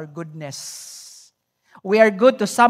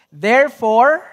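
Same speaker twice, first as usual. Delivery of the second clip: reading scripture aloud together; 4 dB louder than usual. Real, not acted. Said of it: "I want us to read this together".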